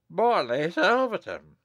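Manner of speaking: high, aghast exclamation; shocked cry. A narrator's reading. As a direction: cry the name out in stunned recognition. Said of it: "cried the abbot".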